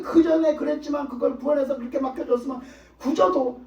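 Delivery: normal talking voice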